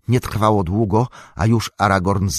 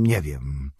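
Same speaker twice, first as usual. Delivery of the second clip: crushed repeat; 6 semitones lower, 5 dB quieter than usual. A narrator's reading. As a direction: lower and softer, sinking into despair. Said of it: "jakby przytłoczony zgryzotą". (ciche, niższe, zrezygnowane powtórzenie)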